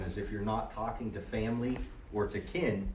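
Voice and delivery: usual speaking voice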